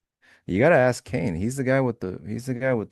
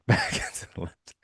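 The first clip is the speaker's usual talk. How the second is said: laughing. Real, not acted. Laughing repeat of a quote.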